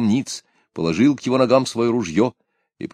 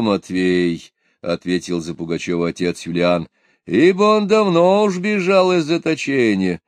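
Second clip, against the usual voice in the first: fervent preaching; 8 semitones higher than usual. A narrator's reading. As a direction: answer with solemn fervour. Priest answers for Pugachev.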